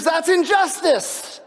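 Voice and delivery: congregation's inner voice crying injustice; scream of outrage. Acted. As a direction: cry out against the injustice. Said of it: "something inside of us that screams".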